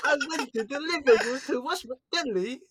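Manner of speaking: hammed-up British accent; high mock British voice. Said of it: "stop being Brits".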